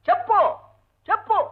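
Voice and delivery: high, insistent repeated tell me; impatient demand. Acted. Husband pressing his wife to say why she came. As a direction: push her to answer, each one sharper.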